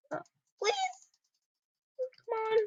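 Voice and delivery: high, soft begging; hushed high plea; pleading whisper. Real, not acted. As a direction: quietly beg the game to cooperate. Pleading with glitch to work.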